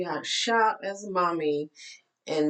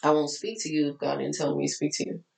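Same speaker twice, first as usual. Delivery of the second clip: normal talking voice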